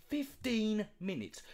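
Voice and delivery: Fifteen minutes dragged out slowly, FIFTEEN stressed; slow, stressed FIFTEEN in disbelief; slow mocking repeat. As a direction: stretch out FIFTEEN with scorn.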